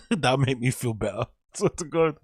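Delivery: words breaking into a real laugh. Real, not acted. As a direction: laugh through it, pitch jumping up. Laughs at own ice lolly wish.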